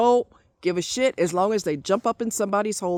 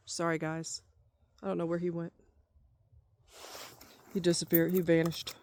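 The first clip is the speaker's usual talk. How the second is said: much softer, lower apology; quiet aside; 4 semitones lower, 10 dB quieter than usual. Real, not acted. Apologising to viewers for the interruption, quietly. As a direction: drop right down to a hushed aside.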